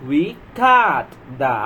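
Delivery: very slow, word by word; slow word-by-word read. Shown as a demonstration. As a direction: read it out slowly for beginners. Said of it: "slow sentence reading demo".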